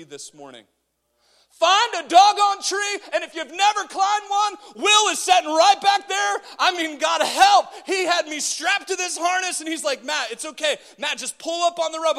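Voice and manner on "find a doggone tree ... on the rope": high, loud shouted exhortation; full-force preaching. Real, not acted. Preacher telling the church to climb a tree, high shout. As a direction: holler the story out at full voice.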